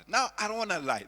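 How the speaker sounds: ordinary talk, nothing marked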